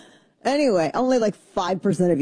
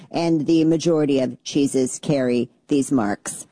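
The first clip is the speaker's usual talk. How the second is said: robot voice; 2 semitones lower than usual. Pretend. Reading dry science about rennet to listeners, robot voice. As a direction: read it like a robot, word by measured word. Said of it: "say it in a robot voice".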